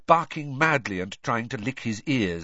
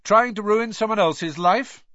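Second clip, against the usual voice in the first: raised voice, higher and louder; loud challenge; 10 semitones higher, 7 dB louder than usual. A narrator's reading. Harry confronting the reporter in the pub, loud and accusing. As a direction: call it out accusingly.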